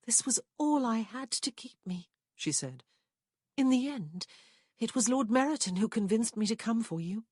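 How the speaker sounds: lightly voiced heartbroken woman; Prue's voice. A narrator's reading. Prue confessing to Rowan what she saw, hurt.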